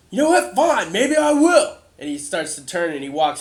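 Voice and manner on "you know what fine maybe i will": high, loud, defiant protest; doubting townsman challenges the new king, loud; angry townsman. Acted. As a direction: shout him down in disbelief. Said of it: "So he's like".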